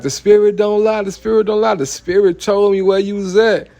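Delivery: mocking a crazed man.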